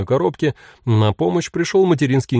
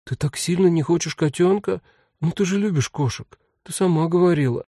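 put-on little girl voice; 7 semitones higher than usual. A narrator's reading. Younger sister voiced.

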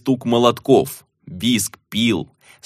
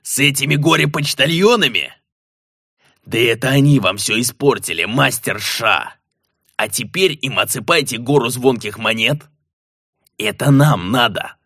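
angry gnome; 2 semitones higher than usual. Acted. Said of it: "взревел Ветхол".

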